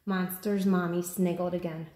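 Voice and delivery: ordinary delivery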